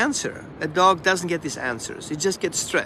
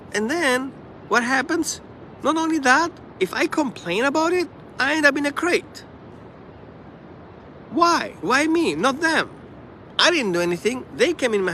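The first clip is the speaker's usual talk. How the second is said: higher, aggrieved dog's complaint; voicing the dog; 6 semitones higher than usual. The dog asks why it ends up crated, a higher aggrieved plea. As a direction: whine as the dog put in the crate. Speaker voices the upset dog.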